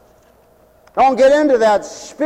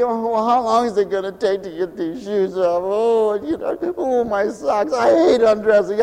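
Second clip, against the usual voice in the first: weary grumble; 4 dB quieter than usual. Retold or imitated. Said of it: "and you think".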